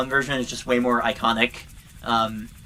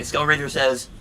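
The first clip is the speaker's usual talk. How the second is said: ordinary delivery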